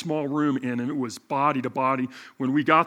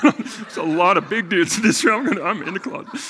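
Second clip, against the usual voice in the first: laughing; 7 semitones higher, 10 dB louder than usual. Real, not acted. Joking about staying closeted.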